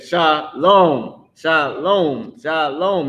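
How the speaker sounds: Shalom as "sha-LOAN", long O, repeated three times; emphasized second syllable. Shown as a demonstration. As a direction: lean on the "lom" of Shalom. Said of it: "trying to put the emphasis on".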